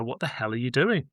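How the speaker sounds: ordinary talk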